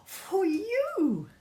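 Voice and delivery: put-on high, loud delighted child; beaming. The little one bringing toys to mum, high and beaming. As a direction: present it proudly, beaming. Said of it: "he beamed".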